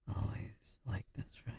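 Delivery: ordinary talk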